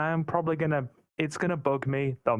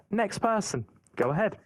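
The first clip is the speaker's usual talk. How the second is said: ordinary talk, nothing marked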